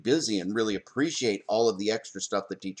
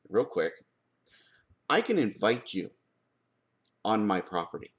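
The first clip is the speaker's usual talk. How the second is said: ordinary talk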